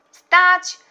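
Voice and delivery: very high, loud halt order; distant shout. A narrator's reading. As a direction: shout a halt across the water. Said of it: "wołał z daleka wuj". (wysoki, głośny krzyk z daleka)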